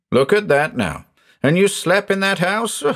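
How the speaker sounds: high, incredulous exclamation; exclaim, climbing high in disbelief. A narrator's reading. Countryman amazed the motorist slept there, exclaiming. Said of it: "he exclaimed".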